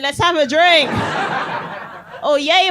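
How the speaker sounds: usual speaking voice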